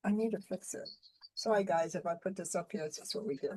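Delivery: faint and distant, off-mic; far from mic. Real, not acted. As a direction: voice lost far from the microphone. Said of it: "it just sounds like you're far away".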